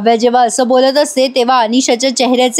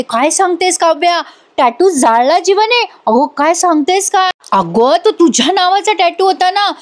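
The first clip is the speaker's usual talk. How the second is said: [name]'s mock yell; 4 semitones higher than usual. Friend hearing Kavya's tattoo was burned, mock yelling. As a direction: imitate an outcry with raised pitch, normal volume. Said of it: "Anisha मोठ्याने ओरडते".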